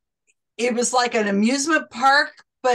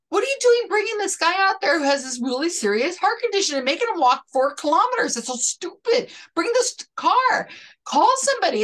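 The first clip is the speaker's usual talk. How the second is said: much higher, indignant mock tirade; retold angry rant; 8 semitones higher than usual. Ranting at the friends who made him walk, high and indignant. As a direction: tear into them for dragging a sick man out, pitched high. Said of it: "I was so pissed off".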